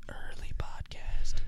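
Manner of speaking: slow whispered aside; whispering. Real, not acted. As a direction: whisper it as a secret. Aside said under breath.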